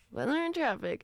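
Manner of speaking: high sing-song station sting; radio jingle voice. Pretend. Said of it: "So imagine I've just played the sting".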